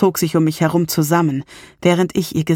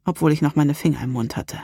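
ordinary delivery, nothing marked

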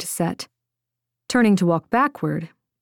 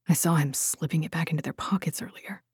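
whispering; 8 dB quieter, faster than usual. A narrator's reading. Secret talk about a traitor.

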